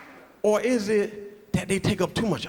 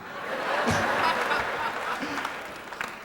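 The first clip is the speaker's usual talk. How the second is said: quiet breathless chuckle; soft airy laugh; 5 semitones higher, 5 dB quieter than usual. Real, not acted. Comic chuckling after his own punchline, soft and airy. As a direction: let out a soft wheezing laugh.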